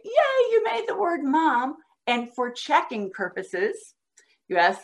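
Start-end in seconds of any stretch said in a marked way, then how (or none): 0.0-1.8 much higher cheer, Yay sung out; cheering a child's success, delighted; cheering praise. Shown as a demonstration. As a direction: celebrate like a proud teacher. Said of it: "Then you say".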